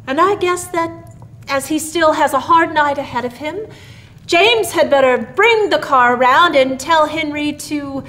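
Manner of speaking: put-on father speaking gravely over the old man. A narrator's reading. Father to son about Santa, gravely. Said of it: "said Mister Harrison gravely".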